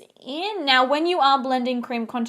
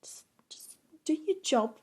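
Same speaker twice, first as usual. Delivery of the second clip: whispered self-pep talk; whispered order to herself; 4 semitones higher, 4 dB quieter than usual. Real, not acted. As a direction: hiss it at yourself under your breath. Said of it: "Calm down".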